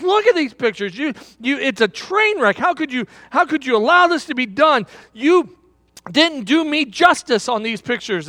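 high-pitched outraged scolding; mock furious complaint. Politician confronting the photographer, in mock fury. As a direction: confront him like an outraged customer. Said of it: "slammed the pictures down on the desk".

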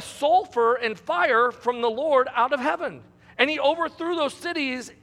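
dramatic scripture narration, lifted voice; scripture reading. Acted. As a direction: read the story out to a congregation. Reads the climax of the passage.

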